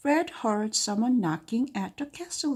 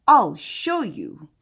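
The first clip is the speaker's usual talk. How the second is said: loud, slow dragon threat; threatening boast; 9 dB louder, slower than usual. Acted. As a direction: say it slow and loud, daring her. Fred threatens Missus Green.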